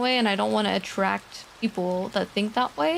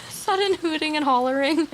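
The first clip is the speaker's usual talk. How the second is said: higher-pitched, laughing through the words; giggly, pitched-up delivery; 6 semitones higher than usual. Real, not acted. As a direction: crack up while saying it. Breaks up laughing while describing ASMR.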